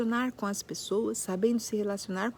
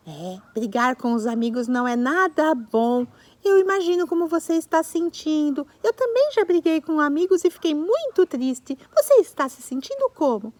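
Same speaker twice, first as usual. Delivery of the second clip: giraffe comforting; 5 semitones higher, 7 dB louder than usual. Pretend.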